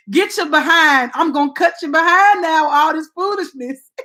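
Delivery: mock scolding.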